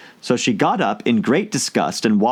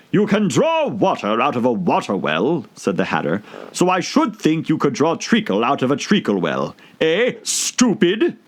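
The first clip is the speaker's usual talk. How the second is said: high, sneering hatter voice; mocking Hatter; 5 semitones higher than usual. A narrator's reading. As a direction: taunt her as the Hatter.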